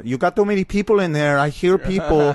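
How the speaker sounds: scolding tenant in a high voice; landlord. Retold or imitated. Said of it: "the landlord accused me of".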